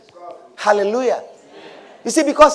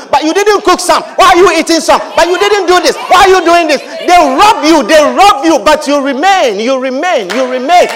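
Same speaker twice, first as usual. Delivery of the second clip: louder, driving pulpit preaching; full-force preaching; 6 semitones higher, 5 dB louder than usual. Acted. Pastor exhorts his congregation about being planted, preaching hard. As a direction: hammer it home to the congregation.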